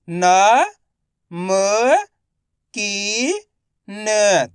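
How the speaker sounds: न, म, की, न each said alone; direct matra sounding. Shown as a demonstration. Teacher showing direct reading, न, म, की, न sounded separately. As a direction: hold न, म and की, pausing between them. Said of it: "direct मात्रा का उच्चारण करके पढ़ेंगे".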